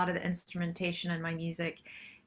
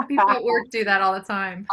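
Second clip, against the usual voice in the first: laughing; 9 dB louder than usual. Real, not acted. Guest laughing while speaking.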